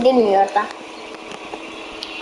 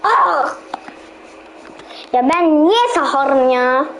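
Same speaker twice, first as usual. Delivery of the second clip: normal talking voice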